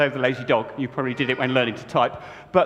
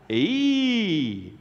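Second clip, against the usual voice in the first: John's call; 3 semitones higher than usual. Acted. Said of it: "said John".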